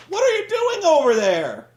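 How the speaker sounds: high, loud, dopey voice asking what's wrong; mocking dumb question. Host mocks the wrestler calling to his fallen partner, high and loud dopey voice. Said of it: "and he says, quote".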